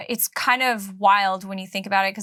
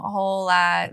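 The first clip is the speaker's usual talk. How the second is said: ordinary talk, nothing marked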